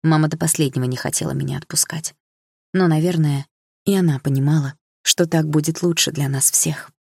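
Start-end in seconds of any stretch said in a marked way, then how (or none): none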